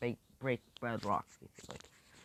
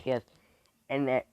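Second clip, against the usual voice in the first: plain reading voice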